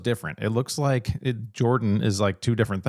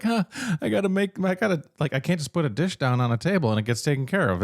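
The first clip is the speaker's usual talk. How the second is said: imitating wife; 6 semitones higher than usual. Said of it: "You're like".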